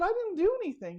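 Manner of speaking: high, flustered modest denial; teacher modestly refusing the former student's thanks; emotional protest. Retold or imitated. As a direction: brush off the thanks, overwhelmed.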